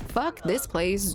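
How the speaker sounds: ordinary delivery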